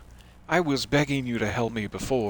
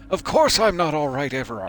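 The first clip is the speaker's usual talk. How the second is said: panicked outburst; 7 semitones higher, 5 dB louder than usual. Acted. Panicking in the interrogation.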